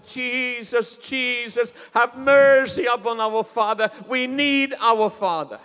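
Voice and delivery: high, loud pleading cry to Jesus; crying out in prayer. Retold or imitated. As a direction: beg for mercy, near tears. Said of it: "we started to cry and we said".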